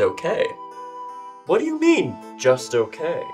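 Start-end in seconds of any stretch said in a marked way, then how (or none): 1.5-2.1 incredulous. Acted. Shocked at a poor night's sleep.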